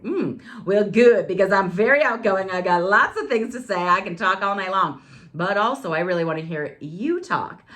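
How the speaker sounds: high, loud, exuberant self-quote; eager chatty reply. Retold or imitated. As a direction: gush back at a date, playing up the outgoing talker. Acted chatty reply to her dates.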